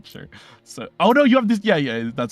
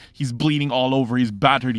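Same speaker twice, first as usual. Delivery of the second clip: ordinary talk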